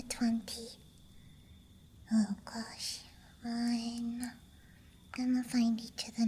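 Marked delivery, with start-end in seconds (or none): none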